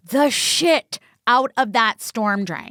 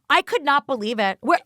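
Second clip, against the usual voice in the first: ordinary delivery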